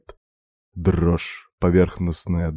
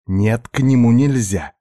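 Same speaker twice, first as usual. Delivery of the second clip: mildly angry, higher and louder; a restrained, tense refusal; stifled growl; 5 semitones higher, 5 dB louder than usual. A narrator's reading. Katya refuses someone on the phone, stifled growl. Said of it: "сдавленно рычала она".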